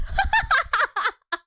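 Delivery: high, loud burst of laughter; big high-pitched laugh; real laughing fit. Real, not acted. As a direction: crack up after a joking flirtation.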